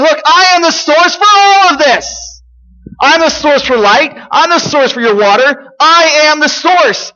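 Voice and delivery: high, forceful proclamation; crying out. Retold or imitated. Preacher voicing Jesus crying out to the crowd, high and emphatic. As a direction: cry it out as a bold claim over a crowd. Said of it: "Jesus cries out saying".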